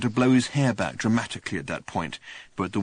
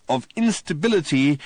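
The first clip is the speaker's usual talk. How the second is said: higher, louder patient overpronouncing; slow and distinct; 5 semitones higher, 5 dB louder than usual. A narrator's reading. Ford explaining to Arthur, slowly and distinctly. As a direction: spell it out like to a slow clerk. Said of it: "as slowly and distinctly and patiently".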